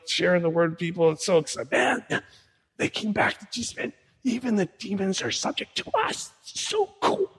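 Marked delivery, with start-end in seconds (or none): 1.7-7.3 high, breathless gushing about the miracles; excited disciples. Retold or imitated. Disciples telling Jesus about the demons, high excited gush. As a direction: gush like a thrilled follower.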